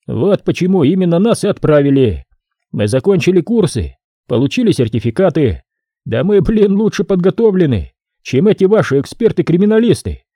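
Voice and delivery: a proud worker bragging; cocky worker. Acted. Mike voiced by narrator.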